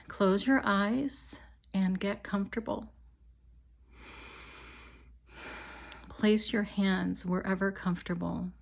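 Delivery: calm, measured meditation voice with long pauses; guided meditation. Real, not acted. Healer leading listeners on an imagined walk to a river, guided meditation. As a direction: guide them gently, leaving space after each phrase.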